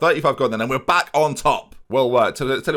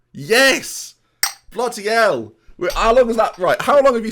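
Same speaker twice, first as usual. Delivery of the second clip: excited disbelief; 11 semitones higher, 5 dB louder than usual. Real, not acted. Realises FA Cup is egg cup.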